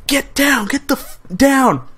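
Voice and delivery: high-pitched mock yell, held back in volume; urgent, high retold yell; imitated yell. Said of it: "He's yelling".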